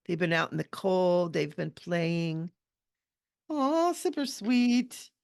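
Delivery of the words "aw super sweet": high, slow, drawn-out aww; cooing. Real, not acted. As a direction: coo over something adorable. Cooing over the drawn elf.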